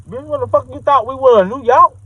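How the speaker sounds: high, sassy disbelief; indignant rant. Real, not acted. Ranting back at the lady on the phone, high and indignant.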